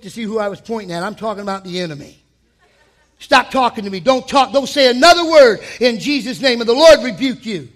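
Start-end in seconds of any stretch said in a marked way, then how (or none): 3.2-7.7 louder, commanding rebuke; forceful rebuke. Acted. Preacher commands the enemy to stop talking. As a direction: order the enemy to be silent.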